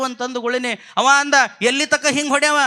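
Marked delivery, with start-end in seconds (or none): 1.6-2.6 protesting shout. Acted. Elder brother shouting in protest.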